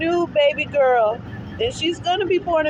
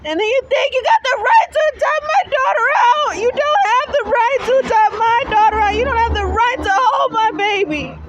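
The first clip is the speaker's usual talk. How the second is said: crying angry outburst; 3 semitones higher than usual. Real, not acted. Mother at the bus stop shouting at the agency through real tears.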